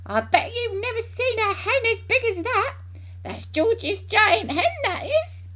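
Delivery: high-pitched, loud boastful holler; grandma shouting. A narrator's reading. Grandma boasting to Mary about the giant hen, shouting. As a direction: call it down loudly in a high old-woman voice. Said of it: "Grandma started shouting at her from the rooftop".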